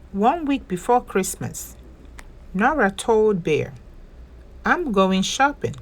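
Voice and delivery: gentle, lively storybook read-aloud; picture-book narration for little listeners; reading to children. A narrator's reading. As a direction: tell it like bedtime story time.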